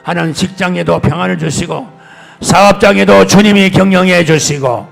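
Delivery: loud, high, intense prayer over music; fervent prayer. Real, not acted. Pastor praying blessings on his congregation, loud fervent prayer. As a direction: pray aloud for the flock with full force.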